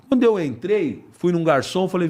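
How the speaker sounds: ordinary delivery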